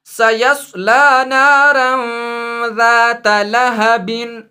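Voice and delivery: very slow, drawn-out Quranic recitation. Shown as a demonstration. Teacher recites Surah Lahab to students in slow recitation. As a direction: chant the verse slowly like tilawat. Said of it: "सूरतुल लहब में यहाँ लिखा है".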